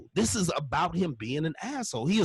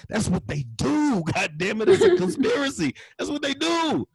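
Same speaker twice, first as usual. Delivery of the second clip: laughing exasperation; 8 semitones higher, 5 dB louder than usual. Retold or imitated. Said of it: "I'm like".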